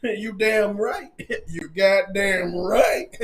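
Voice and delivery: low, slow drawn-out relish; self-satisfied, dropped in pitch; cocky agreement. Acted. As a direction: chuckle and stretch it out smugly. Acting out the smug proposer.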